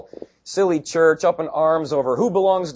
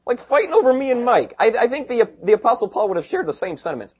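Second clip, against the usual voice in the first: ordinary talk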